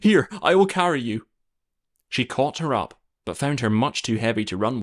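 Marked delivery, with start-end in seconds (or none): 0.0-1.2 a hint of a frantic nurse, a bit louder; hurried nurse offering help; flustered nurse. A narrator's reading. Nurse offers to carry the princess, mildly frantic.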